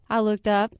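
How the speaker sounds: ordinary delivery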